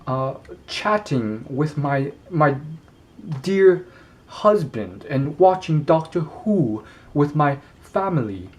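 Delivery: amateur attempt at a British accent, halting in places; bad British accent. Shown as a demonstration. Man admitting it will suck, attempts a British accent. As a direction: put on a hammed-up British accent. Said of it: "try my best to do a British accent".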